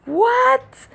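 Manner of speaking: very high, loud disbelieving what; stunned what. Real, not acted. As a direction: squeal it in shock. Her stunned reaction to his outburst.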